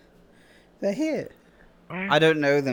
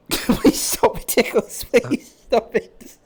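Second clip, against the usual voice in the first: being tickled; 6 semitones higher, much slower than usual. Acted.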